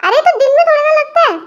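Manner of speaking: put-on high girlish whine. Girl whining to uncle.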